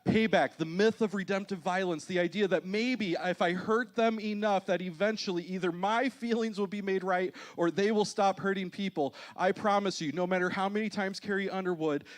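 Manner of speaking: high, loud, driving sermon delivery; emphatic preaching. Real, not acted. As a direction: preach it with force, hammering each phrase. Preacher drives the point home.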